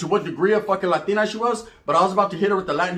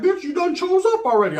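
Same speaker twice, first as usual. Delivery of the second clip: mock high retort; 11 semitones higher than usual. Brother retorts to girl.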